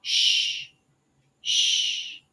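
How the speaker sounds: long Shh with finger to lips; shushing. Shown as a demonstration. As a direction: hush someone with Shh. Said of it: "as if you're shushing someone".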